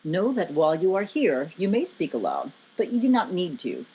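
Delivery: deep, measured authority; very authoritative. A narrator's reading. Giant instructing his guest, very authoritative and low. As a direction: pronounce it low, like a commanding giant. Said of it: "Lokar spoke this with a very authoritative tone".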